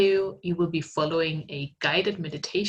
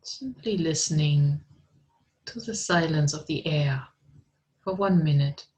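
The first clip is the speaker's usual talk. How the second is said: guided meditation; 3 dB louder than usual. Acted. Meditation guide leading students in art therapy, calm and unhurried. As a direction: ease listeners into stillness, unhurried.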